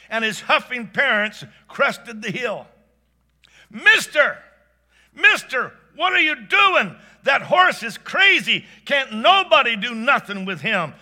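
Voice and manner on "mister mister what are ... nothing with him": high, loud panicked warning yell; alarmed shouting. Acted. Country teenager warning a stranger off his horse, alarmed shout. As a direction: call out to stop him, alarmed.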